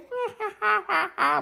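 put-on a high, happy baby laugh; high baby giggle. Babies laughing.

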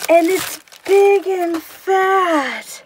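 real delight, stretched out; excited gushing. Real, not acted. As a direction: keep gushing over the gift. Gushing over the gift.

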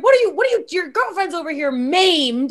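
high, loud panicked scolding; retold frantic yell, stretching the last words; freaking out. Telling boyfriend his girlfriend is maimed, freaking out. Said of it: "I started freaking out".

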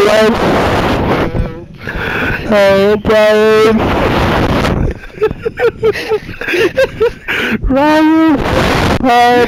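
hammed-up calling for help over the wind; mock call for help.

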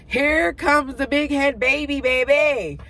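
loud, slow, singsong entrance call; playful announcement. Pretend. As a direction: announce it like a showman. Announcing own birth playfully.

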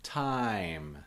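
TIME drawn out, long falling I; going slow. Shown as a demonstration. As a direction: stretch TIME slowly and clearly. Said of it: "I'm going slow".

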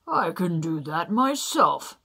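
loud, slow giant voice; Welsh giant. Acted. Welsh giant scoffing at little Jack, loud and slow. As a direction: boom it out like a boastful giant. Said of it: "said the Welsh giant".

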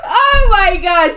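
high loud laugh into slow words; hearty laughter. Real, not acted. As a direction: burst out laughing, then sigh it out high. Laughing after the scare.

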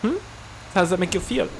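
needling mock German questions; German accent. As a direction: probe him in a fake German accent. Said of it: "I don't know why they're German".

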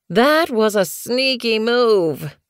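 high, drawn-out yowl; yowled complaint. A narrator's reading. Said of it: "Dapple Paw yowled".

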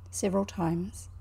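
ordinary delivery

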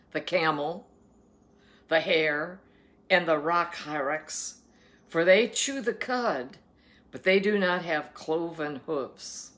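slow, emphatic Bible reading; scripture reading. Acted. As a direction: proclaim the food laws, weighting each word. Reads scripture aloud.

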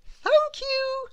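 very high lightly voiced woman; woman's voice. A narrator's reading. Woman in Goodland thanking Mister Good, in a very high voice. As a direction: thank him brightly. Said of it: "said a woman".

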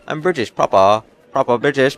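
hammy posh British voice; playful fake British accent; mock British accent. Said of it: "Why are they talking so".

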